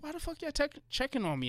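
high, exasperated why-are-you-checking-on-me; half-joking annoyed reply to family texts; incredulous quote. Retold or imitated. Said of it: "I'm like".

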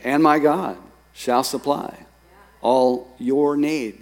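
"And my God shall supply all your need" drawn out slowly, each word given weight; slow, weighty reread. Shown as a demonstration. Pastor rereading the verse to the congregation, slow and deliberate. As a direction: linger on "all your need", let it sink in. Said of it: "I feel the need to read that verse again".

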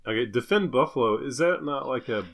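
ordinary talk, nothing marked

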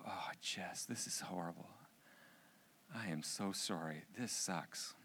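soft, hushed consoling; tender sympathy. Retold or imitated. Father consoles daughter who starts bawling, tender sympathy. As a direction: comfort a crying child quietly.

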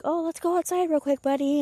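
put-on high-pitched talk to a little child; chirpy parent voice. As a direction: coax a small kid in a high voice. Parent voiced talking to young son.

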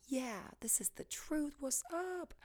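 a breezy whispered mock of the enlightened self; smug conscious friend. You with conscious friends, softly mocking whisper. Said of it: "you're like".

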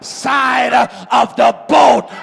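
loud whooping sermon build; preacher building to the climax over the organ; preaching at full force. Acted. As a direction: drive it home to the church.

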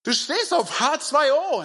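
very high, stretched-out amazement; incredulous buddy. Acted. Jesus putting an arm round Petrus, incredulous buddy. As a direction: marvel at a pal in a high voice.

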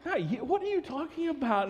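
baffled dad. Retold or imitated. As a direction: sound confused.

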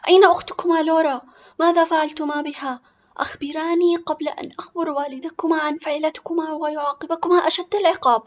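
high, loud, furious mother; scolding at full voice; very angry. Acted. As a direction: demand answers and threaten punishment. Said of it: "وبغضب شديد قالت".

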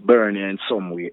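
usual speaking voice

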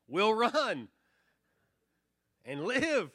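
high, loud yell from the ranks; yelled reply. Acted. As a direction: call it out across a battlefield, pitched high. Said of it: "yells out".

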